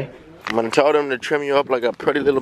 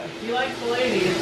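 higher, softer amused echo; soft aside; 9 semitones higher, 6 dB quieter than usual. Real, not acted. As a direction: murmur it back, amused. Repeating groomer's remark.

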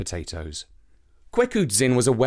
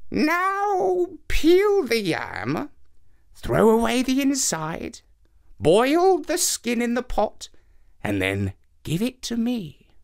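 put-on higher old woman giving instructions; old woman's voice; 10 semitones higher, slower than usual. Old woman gives strange orders.

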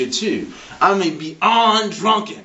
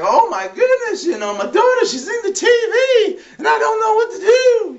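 very high-pitched frantic mother voice; mock panic; 3 dB louder than usual. Mother alarmed that her daughter's in the TV, mock panic. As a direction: wail it like a hammed-up panicked mom.

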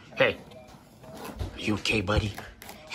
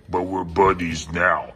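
slow-mo; 9 semitones higher, 4 dB quieter than usual. Real, not acted. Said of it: "here's the slow-mo version".

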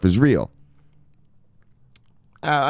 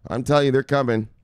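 ordinary talk, nothing marked

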